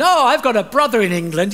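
butter up the customer; imitated trader's eager patter; eager shopkeeper. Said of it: "sales patter".

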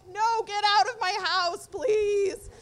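loud, drawn-out retold demand; acted fed-up plea, loud and slow; exasperated order. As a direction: order him out at full voice, slowly. Retold kicking out of a guest.

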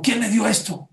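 startled demand for who gave it; alarmed pharmacist. Acted.

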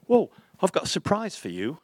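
high-pitched madman drummer voice; imitating an English rocker announcing a surprise. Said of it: "he walked in and goes".

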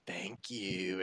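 soft, badly failed little-girl accent; hushed creepy thank-you; sinister little girl. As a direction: try for an innocent country girl and land on sinister. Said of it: "she sounds sinister".

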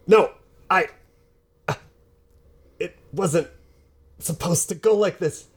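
high, frantic plea for help; panicked pleading. Acted. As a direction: beg Darren desperately. Captured villain pleads, high pitch.

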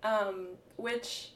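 normal talking voice

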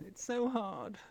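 put-on little whine; mock lament. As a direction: imitate the series' moaning, softly. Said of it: "that's all they say on this series".

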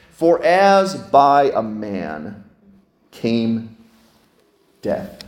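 very slow, weighty reading; solemn recitation of the verse; scripture reading. Acted. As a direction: let each phrase land.

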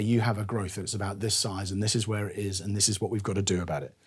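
soft, quiet doctor breaking the news; a hint of a calm doctor at the bedside; doctor telling the diagnosis. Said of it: "And you say".

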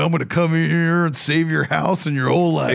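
lightly mocking home show host; a hint of a cocky TV contractor; TV renovator voice. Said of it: "he's like".

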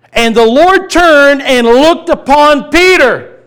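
loud and slow, dwelling on each word; the Lord's gaze proclaimed at the top of his voice; thundering climax. Acted. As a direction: preach it at full force, slowly. Climax of the scripture reading.

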